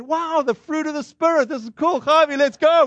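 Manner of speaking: high-pitched, hammed-up amazement; excited delight. Visitor calling his friend to leave with free pears, excited.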